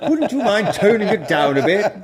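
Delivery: put-on high, prim posh accent. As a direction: put on a snooty posh voice to mock politeness. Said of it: "bit like in a posh voice".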